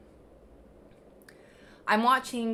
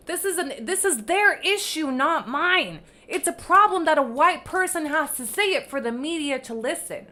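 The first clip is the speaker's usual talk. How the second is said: louder, higher, pressing each point; emphatic ally version; 5 semitones higher, 7 dB louder than usual. Retold or imitated. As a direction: insist on it like it matters. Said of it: "what she, a real ally would have said".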